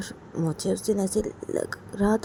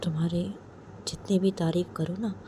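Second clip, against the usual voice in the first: usual speaking voice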